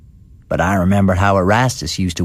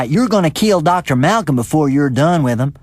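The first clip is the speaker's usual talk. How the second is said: accusing; 8 semitones higher than usual. A narrator's reading. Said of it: "Says I".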